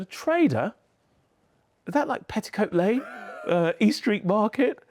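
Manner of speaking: incredulous teacher. Retold or imitated. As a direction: she can't picture it. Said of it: "And she said".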